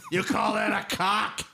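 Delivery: Vince McMahon voice. Pretend. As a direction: yell it like a wrestling boss. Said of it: "You sound like Vince McMahon in the afterlife".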